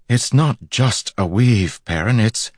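high, slow, emphatic objection; sputtering protest. A narrator's reading. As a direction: protest in disbelief, then break off. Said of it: "leaving Egwene sputtering".

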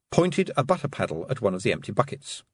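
usual speaking voice

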